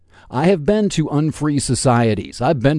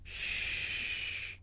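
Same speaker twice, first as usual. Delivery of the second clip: whispered shush, much softer; hushing whisper; 4 semitones lower, 9 dB quieter, much slower than usual. Acted. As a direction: hiss it quiet to silence the voice. Hushing his own mock voice.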